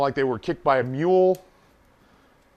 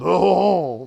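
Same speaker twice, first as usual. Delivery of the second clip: drawn-out, hammed-up "oh"; lewd mock groan; 3 semitones higher, 4 dB louder than usual. Bragging to a pal about a hookup, lewd mock groan. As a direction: groan it out like a crude boast.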